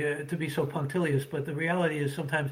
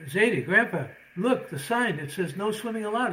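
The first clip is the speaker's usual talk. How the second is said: grandson voice; 6 semitones higher, 3 dB louder than usual. Acted.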